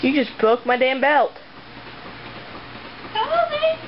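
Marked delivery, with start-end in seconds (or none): 3.1-3.8 calling out. Real, not acted. Calling the dog Polly.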